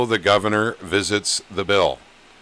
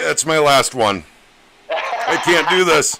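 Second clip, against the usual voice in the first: pained after shot; 7 semitones higher, 4 dB louder than usual. Real, not acted. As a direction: squeeze it out through a wince. Reacting to the tequila burn.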